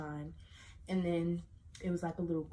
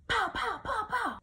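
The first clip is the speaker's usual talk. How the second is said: high, punchy pow sounds; sharp, high mock gunfire; gunshot imitation; 5 dB louder than usual. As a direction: mimic the shots popping off.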